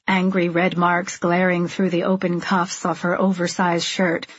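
ordinary delivery, nothing marked

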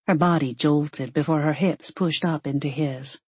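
plain reading voice

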